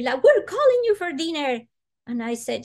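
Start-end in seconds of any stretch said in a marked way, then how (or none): 0.2-1.6 high, loud scolding call; angry maid. Retold or imitated. Maid scolding the child about dinner, angry and loud. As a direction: scold the child for not coming. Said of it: "she comes all angry".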